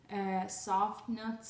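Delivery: ordinary talk, nothing marked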